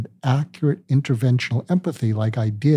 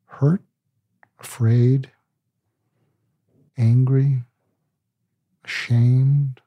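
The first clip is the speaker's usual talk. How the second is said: each word set apart by long pauses; slow empathic list; much slower than usual. Shown as a demonstration. Counsellor naming feelings to a hurting person, slow and paused. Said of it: "the way you say it is you're a syringe going into an abscess".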